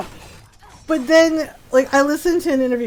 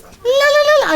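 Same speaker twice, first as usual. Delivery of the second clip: high ululating la la la, louder; Xena war cry try; 14 semitones higher, 6 dB louder than usual. Shown as a demonstration. Streamer trying Xena's war cry, high ululating yell. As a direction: attempt Xena's warrior yell and fail. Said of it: "I can't do the yell".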